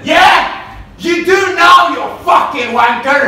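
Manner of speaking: angry, high-pitched yell from the back; heckler's shout. Retold or imitated. Heckler at the back answers the comic, shouting. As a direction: bellow it across a rowdy club. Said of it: "he shouted".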